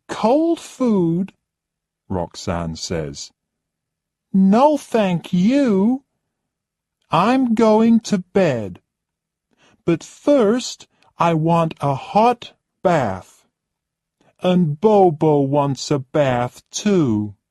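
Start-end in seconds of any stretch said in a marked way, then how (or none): 0.1-1.3 scoffing disbelief. Acted. Spoiled guest scoffs at cold food. 4.3-17.4 much higher and louder, a demanding whine; haughty high-pitched complaining lady; spoilt rich guest. Acted. Narrator voices spoilt Roxanne.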